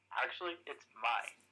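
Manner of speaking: ordinary delivery, nothing marked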